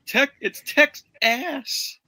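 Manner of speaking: high, slow goofy drawl; mock slow goofy voice. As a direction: stretch it out in a silly high voice. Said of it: "the guy's like".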